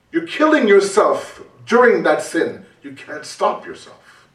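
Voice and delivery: usual speaking voice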